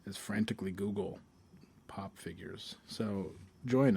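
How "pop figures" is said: whispered. Real, not acted. Trailing aside.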